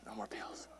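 barely audible whisper; whispered aside. Real, not acted. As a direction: whisper it to yourself as a joke. Joking aside, repeated in whisper.

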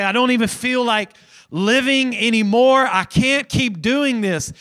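high, loud cry of despair; a preacher's loud cry of despair. Retold or imitated. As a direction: cry out as if at the very end. Voicing someone at the end.

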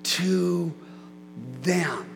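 word by word, pausing after each; slow, deliberate emphasis. Real, not acted. Preacher dwelling on the verse for the church, slow and deliberate. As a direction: let every word hang in the air.

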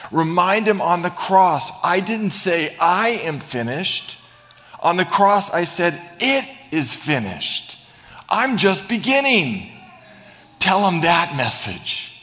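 high, loud, slow preaching climax; full-force preaching. Acted. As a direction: drive the message home slowly.

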